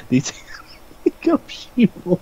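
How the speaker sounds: giggling through a mock conspiracy line; laughing, half-whispered crank voice. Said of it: "conspiracy crazy person thing".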